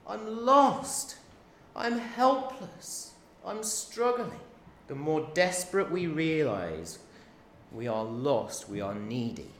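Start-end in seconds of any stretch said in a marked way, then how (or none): 0.1-4.4 much higher, slow, pleading voice; deep yearning cry. Acted. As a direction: yearn for rescue. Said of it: "this deep cry out".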